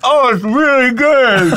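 choked laugh through a mouthful; laughing while gagging. Real, not acted. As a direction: laugh it out, barely holding it down. Laughing through a disgusting bite.

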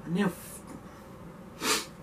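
swallowing the swear word; choked-off whisper. Real, not acted. Breaking off mid-word in frustration.

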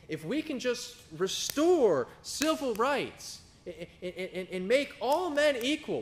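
high, sarcastic mock preacher voice, slow at first. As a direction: pretend to swallow their big promise, then mock it. Mocking the world-fixers' big talk.

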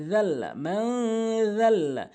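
জাল্লা as "dhalla", tongue tip at the teeth; soft dhal. Shown as a demonstration. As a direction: say both জাল্লা with a voiced "th", unforced. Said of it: "জিব্বার আগা দাঁতের আগে".

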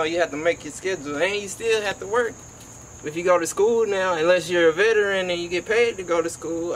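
ordinary delivery

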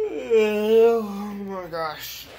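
yawned out slowly, high and loud; yawning. Real, not acted. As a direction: say it through a big yawn. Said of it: "I'm sorry for the yawn".

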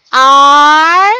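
"R" drawn out loud and held; isolated first syllable. Shown as a demonstration. Teacher splitting a name for pupils, "R" stretched out alone. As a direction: hold "R" long as a syllable by itself. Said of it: "how many syllables do we have".

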